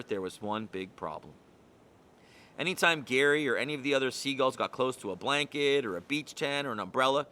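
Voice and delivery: ordinary delivery, nothing marked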